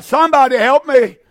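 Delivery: anguished shout for help; crying out for help. Acted. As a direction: plead loudly like a man in torment. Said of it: "and you cry out".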